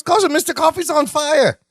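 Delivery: alarmed foreign cousin, hammed a little; mock Balki alarm. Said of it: "Balki goes".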